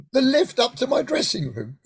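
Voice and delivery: savouring the news in delight; amazed realisation. Retold or imitated. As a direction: let it sink in with wonder. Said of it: "The what?".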